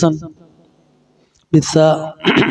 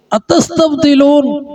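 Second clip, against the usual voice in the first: rebuking اتستبدلون, slow and rising higher; scolding question; 8 semitones higher, slower than usual. Shown as a demonstration. Moses rebuking his people: a slow, high scolding. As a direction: scold them with the word اتستبدلون. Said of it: "اس میں ڈانٹ ہے،".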